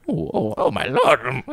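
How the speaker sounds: slow, soft scandalised gasping; shocked old lady. Retold or imitated. Old lady catching him reading, slow shocked voice. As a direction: drawl it out like a scandalised granny. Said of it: "I've heard".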